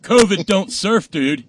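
loud, high, drawn-out holler; mock-macho surfer yell; shouted parody line. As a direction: bellow it like a war-movie catchphrase.

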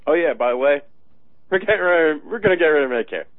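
high, loud mocking politician. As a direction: sneer it like a smug politician. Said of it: "the first thing they say is".

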